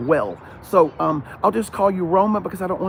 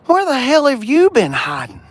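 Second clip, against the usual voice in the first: higher, louder excited call; 6 semitones higher, 5 dB louder than usual. Real, not acted. Excited greeting to the trucks.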